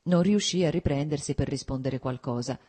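plain reading voice

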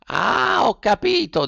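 high, drawn-out snail realization; the snail finally understands, up high. A narrator's reading. (lumaca che capisce)

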